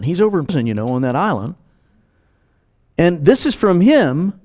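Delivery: normal talking voice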